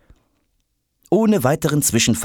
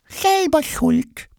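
high, slow little creature voice; heartless; much slower than usual. A narrator's reading. Retainer box about the lost bag, heartless. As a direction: squeak it slowly, without sympathy. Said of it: "meint Zani herzlos".

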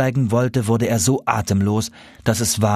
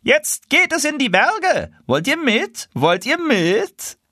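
high, slow, sing-song goose voice calling down; a wild goose luring the tame ones, high and slow; slower than usual. Acted. Wild geese calling to the tame geese, high and slow.

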